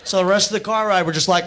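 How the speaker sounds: ordinary delivery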